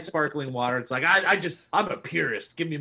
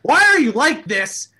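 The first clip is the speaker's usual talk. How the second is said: high, loud, slow exasperation; exasperated; 11 semitones higher, 10 dB louder than usual. Retold or imitated. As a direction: plead in exasperation. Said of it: "I'm just like".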